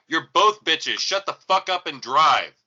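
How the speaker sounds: loud, fed-up telling-off; loud snapping. Retold or imitated. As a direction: shout them both down. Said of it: "I'm like".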